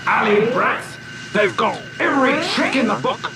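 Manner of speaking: high, loud professor's outburst; loud shout. A narrator's reading. Professor Gamma rails at the alley brats, shouting. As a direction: shout it out in high-pitched outrage. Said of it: "shouted Gamma in a voice so loud".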